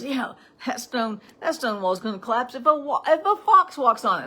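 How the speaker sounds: high, loud mocking with a laugh; Tobiah's jeering. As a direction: imitate a bully joining in, building to a loud, laughing jeer. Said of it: "Then Tobiah joins in".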